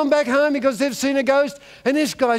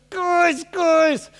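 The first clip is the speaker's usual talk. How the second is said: calling out; 8 semitones higher than usual. Acted. Calls after running friends.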